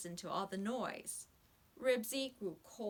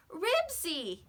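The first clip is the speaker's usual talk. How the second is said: high, loud call of a dog's name; 8 semitones higher, 5 dB louder than usual. A narrator's reading. Henry calling to his lost dog from the car. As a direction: call out to him from far away.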